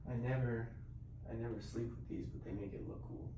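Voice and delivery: hushed, soft-spoken morning talk; quiet. Real, not acted. I gotta be a little quiet 'cause Sean is sleeping.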